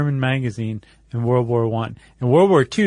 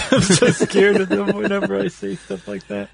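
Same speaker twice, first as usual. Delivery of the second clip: laughing; 6 semitones higher than usual. Real, not acted. Laughs at his own remark.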